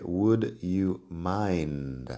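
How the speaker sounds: drawn out word by word; very slow, spelled out. Shown as a demonstration. Teacher drilling the phrase slowly. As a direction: stretch "would you mind" very slowly. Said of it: "varios ejemplos de".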